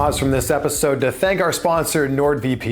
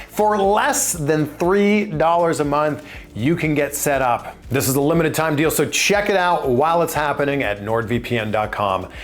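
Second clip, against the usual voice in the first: normal talking voice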